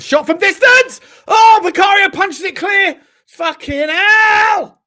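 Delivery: loud, high, slow panicked yelling; panicked shout. Real, not acted. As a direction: shout as the shot flies in. Tense live moment at goal.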